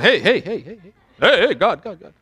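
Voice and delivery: comic self-made echo on each word; mock echo. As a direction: imitate an echo, repeats bouncing away. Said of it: "Where the heck do we get echo?".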